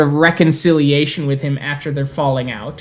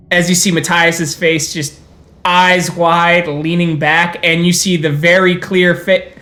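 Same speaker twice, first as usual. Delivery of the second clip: normal talking voice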